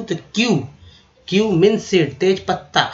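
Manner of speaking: ordinary talk, nothing marked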